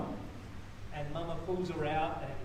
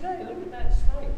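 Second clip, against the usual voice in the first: mock alarm; 6 semitones higher, 7 dB louder than usual. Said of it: "I said".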